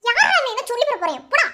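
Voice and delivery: loud, high-pitched retort; angry outburst. Acted. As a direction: burst out at him, then dismiss him. Snaps back in a quarrel.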